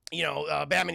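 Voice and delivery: usual speaking voice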